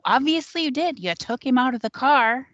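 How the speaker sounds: mock-angry comeback; mad accusation. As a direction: throw the blame back, retold and indignant. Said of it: "She's mad at me".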